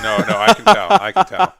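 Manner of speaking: high, loud laughing reply. Real, not acted. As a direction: laugh through the words. Laughing at tired friend.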